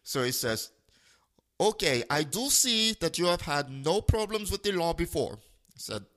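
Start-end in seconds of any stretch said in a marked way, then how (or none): 1.6-5.3 put-on mild Indian-accented caller, slightly higher; imitated Indian accent. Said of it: "duplicate his voice and accent".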